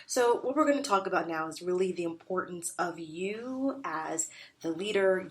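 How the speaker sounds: ordinary talk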